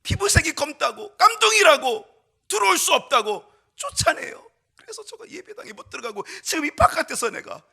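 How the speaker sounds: put-on high, loud anguished plea of a wronged boy; pleading child. Boy telling Jesus he was thrown out, loud high pleading. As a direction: cry out the hurt of being shut out.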